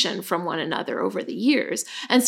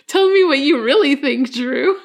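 mock offended; 5 semitones higher, 5 dB louder than usual. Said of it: "it really sounded insulting".

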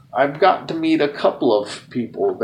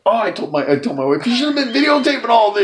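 excited gushing; 8 semitones higher, faster than usual. Retold or imitated. Retold friend gushing.

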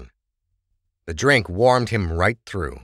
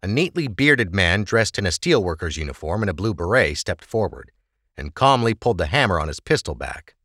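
usual speaking voice

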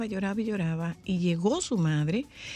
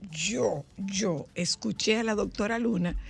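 usual speaking voice

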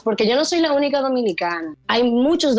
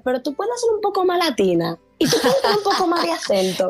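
high, laughing mock plea, much higher pitch; laughing high-pitched send-up of casting directors; mimicking casting people; 8 semitones higher than usual. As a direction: playfully imitate the directors asking for more accent. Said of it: "todo el mundo me decía".

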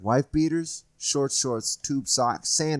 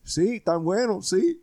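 put-on much higher old man voice; higher cartoonish old man; mock old Puerto Rican man; 10 semitones higher, slower than usual. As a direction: play the innocent old neighbor. Old man answering cops.

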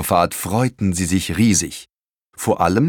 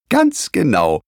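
pleased grandma; 7 semitones higher, 3 dB louder than usual. Acted. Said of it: "freute sich Oma".

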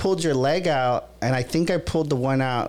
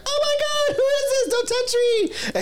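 mock shrill squeal, much higher; panicked drunk girl. Imagining the drunk girl waking up, squealing in mock panic. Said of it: "for this girl to be like".

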